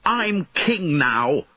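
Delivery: slow, high defiant shout; defiant shout back. A narrator's reading. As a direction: shout back to assert your rank. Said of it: "Einan shouted back".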